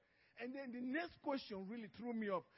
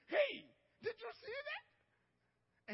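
much higher, slow, stretched-out amazement; astonished Pharaoh; much slower than usual. Retold or imitated. Pharaoh gaping as Moses' snake swallows the sticks, high and drawn out. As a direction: drag it out like a stunned king.

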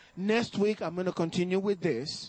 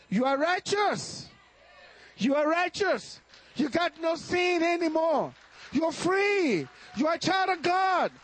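higher and louder, building to a shout; rising preaching shout; 7 semitones higher, 3 dB louder than usual. Real, not acted. Preacher proclaiming to the congregation, building to a shout. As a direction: proclaim it over them, climbing.

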